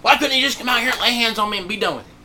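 imitated grumble tailing into da-da-da, then picking up; mock-complain, rattling on in a put-upon voice; grumbling and complaining. Preacher imitates Naaman wishing for hands laid on him, mock complaining. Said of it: "grumbling and complaining".

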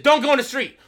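put-on loud high yell at a child; shouted warning. Father shouting at his daughter in the street story, loud yell.

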